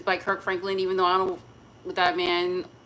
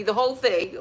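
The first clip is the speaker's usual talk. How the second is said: usual speaking voice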